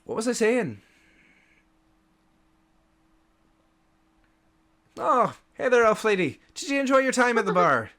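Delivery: high, rapid overly sweet chatter; flustered bartender. Acted. Dazed bartender chatting to the elf, overly sweet and rapid. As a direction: babble cheerfully to hide confusion.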